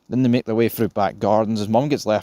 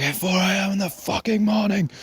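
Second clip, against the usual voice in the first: much higher, put-on voice of a grumpy roommate woken at four; annoyed roommate; 11 semitones higher than usual. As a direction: squeal the complaint as the irritated roommate. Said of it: "He's like".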